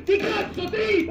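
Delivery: raging yell. Real, not acted.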